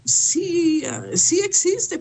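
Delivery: lightly mock the vague believers; imitating believers. (imitando con tono mucho más agudo)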